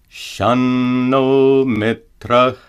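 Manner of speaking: slow chanted Shanno Mitra with dental na; Sanskrit example. Shown as a demonstration. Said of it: "For example".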